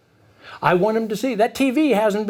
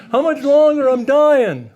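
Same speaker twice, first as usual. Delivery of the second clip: higher, louder mock whine; whining kid; 5 semitones higher, 4 dB louder, slower than usual. Son whining in the kitchen before dinner, mock complaint. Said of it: "he's in the kitchen whining".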